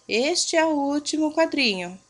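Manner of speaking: normal talking voice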